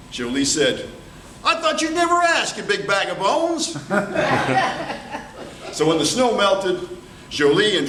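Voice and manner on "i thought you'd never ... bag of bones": high gleeful reply; delighted. Acted. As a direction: burst out happily. Jolie accepts proposal.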